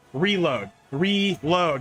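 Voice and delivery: Reload sounded out as ree-LOAD, two beats; counting the beats of Reload aloud; syllable demo. Shown as a demonstration. Streamer to chat, counting syllables. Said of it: "That's two syllables".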